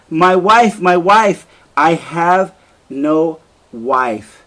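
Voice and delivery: a husband realizes he has killed his wife, anguished; anguished realization. Acted. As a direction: let it break into horror. Othello realizes what he did.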